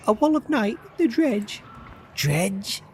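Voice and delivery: startled questions back at the serpent; alarmed Juno. Acted.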